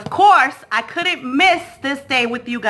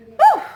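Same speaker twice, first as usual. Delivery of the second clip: high oof; 5 dB louder than usual. Real, not acted. Exclaiming after dancing.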